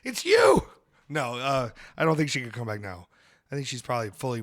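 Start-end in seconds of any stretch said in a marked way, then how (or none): 0.0-0.7 mock amazement. Mock sighting of [name].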